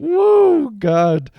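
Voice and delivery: high, loud "whoa" of shock; stunned reaction. Acted. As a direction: react as if bowled over by the story. Reacting aghast to the story.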